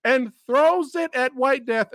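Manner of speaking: high-pitched, laugh-broken incredulity; worked-up, exasperated comic recap; incredulous rant. Real, not acted. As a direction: tell it high, barely holding back laughter. Incredulous retelling of absurd ending.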